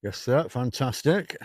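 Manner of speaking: ordinary delivery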